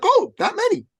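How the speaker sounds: very high, loud surprise; shocked question. Retold or imitated. Client to coach Bill, shocked high squeak.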